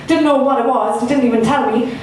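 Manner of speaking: usual speaking voice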